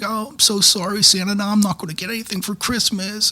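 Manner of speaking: high, soft imitation of a weeping child; mock a tearful child's apology, high and slight; crying little girl. Little girl apologizing to Santa for an accident, imitated crying. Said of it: "she starts crying and crying and saying".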